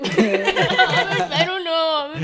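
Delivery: giggling through embarrassed words; laughing. Real, not acted. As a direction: laugh it out, slowly and loud. Embarrassed to do the intro.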